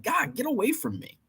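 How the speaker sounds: high, soft whiny brush-off; high hushed mock annoyance; mock irritated. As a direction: shoo them off in a whine. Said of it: "it's like".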